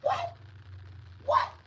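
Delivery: very high, loud shocked cries; a mother who can't believe what she hears; hammed-up shrill disbelief. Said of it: "she was like".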